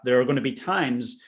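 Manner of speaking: usual speaking voice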